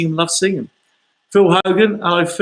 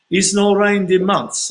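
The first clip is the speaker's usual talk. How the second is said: higher, slow mock Portuguese accent; wobbly, drawn-out Portuguese accent, pitched up; attempted Portuguese accent; 4 semitones higher, 3 dB louder, slower than usual. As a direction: imitate an insistent Portuguese local, badly. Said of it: "Can't do a Portuguese accent".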